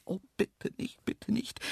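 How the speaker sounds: high, hushed, frightened plea; desperate pleading. Acted. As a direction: beg in a small high voice. Panicked plea over star chart.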